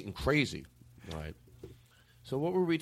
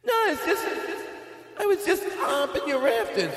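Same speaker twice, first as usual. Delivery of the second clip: a higher, jittery comic; pitched-up nervous pleading; 7 semitones higher than usual. Pretend. Playing breakdown comic talking to Jay.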